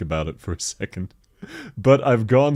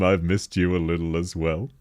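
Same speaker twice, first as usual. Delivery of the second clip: ordinary talk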